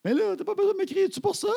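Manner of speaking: very high-pitched mock screech; outraged teen protest. Daughter overreacts to calm request, high outraged screech. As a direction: imitate a teenager wildly overreacting. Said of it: "elle avait dit ça".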